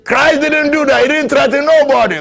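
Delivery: high, loud, laughing through the words; amused, high preaching outburst; laughing preacher. Acted.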